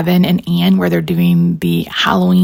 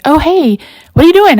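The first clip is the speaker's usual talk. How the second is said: high, louder mock sweet greeting; friend's greeting; 9 semitones higher, 4 dB louder than usual. Teacher friend popping in, high chirpy hello. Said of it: "and she's like".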